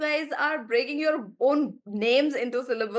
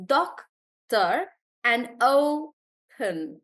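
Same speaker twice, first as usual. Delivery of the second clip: slow, drawn-out words split into syllables; slow syllable demo; much slower than usual. Shown as a demonstration. Teacher showing the class how to break words, slowly. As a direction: stretch each syllable out slowly. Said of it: "teacher demonstrates syllable breaking".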